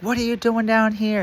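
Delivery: high mock alarmed demand; startled sheriff. Female sheriff confronts inmate.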